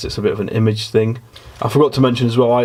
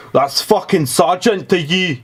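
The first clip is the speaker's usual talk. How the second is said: high, sharp sergeant's snap; Sergeant Brady barking; 8 semitones higher than usual. Retold or imitated. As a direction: bark it like a strict female drill sergeant. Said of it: "And she was like".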